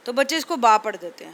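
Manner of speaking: ordinary delivery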